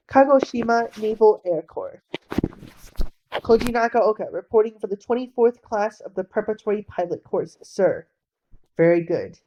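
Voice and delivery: very loud barked report; military shout. Acted. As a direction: report at full volume to the officer.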